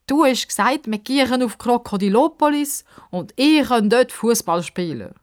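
high, upset accusation; almost shouting. A narrator's reading. Girl holding him to his promise, almost yelling. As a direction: protest hard, falling away at the end. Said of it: "Pippa brüllt fast".

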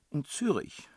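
put-on high, tearful child voice; sobbed child's reply. A narrator's reading. Child tells princess where mother lives, high sobbing. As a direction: answer through acted sobs. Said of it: "Das Kind schluchzte".

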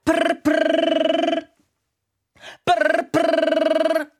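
high trilled prr like a telephone ring; buzzing prr prr of a ringing phone. Acted. Phone rings in the office.

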